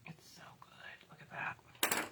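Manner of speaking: soft breathy whisper. Real, not acted. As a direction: whisper it close to the mic. Whispered aside to camera.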